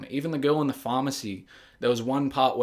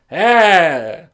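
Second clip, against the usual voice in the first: mock shout; 3 semitones higher, 13 dB louder than usual. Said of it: "grabs a big timeline and goes".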